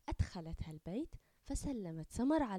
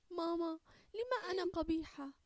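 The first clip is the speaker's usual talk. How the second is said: tearful question; 8 semitones higher, 4 dB louder than usual. Acted. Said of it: "بعينين تملأهما الدموع".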